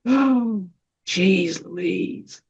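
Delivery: soft exhaled exasperation; acted sigh of letting anger go. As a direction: let it out on a long breath, quietly. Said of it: "I take this deep breath".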